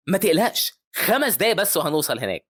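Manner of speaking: happy pilot, voice lifted. A narrator's reading. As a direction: reassure them with upbeat delight. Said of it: "وهو مبسوط قوي".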